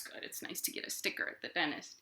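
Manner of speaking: quick, voiceless whisper; whispered aside. Acted. Storyteller whispering to the narwhal puppet about dentist stickers. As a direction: murmur it fast under your breath.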